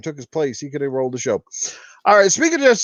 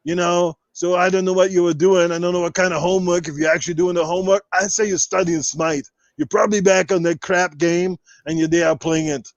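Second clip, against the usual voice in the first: full podcast rant in a held Arnold voice; Austrian-accented action-star voice, kept up line after line; Arnold Schwarzenegger impression. Podcast host answering viewers in character as Arnold, Austrian action-star voice. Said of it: "enough Arnold questions".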